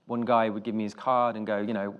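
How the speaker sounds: normal talking voice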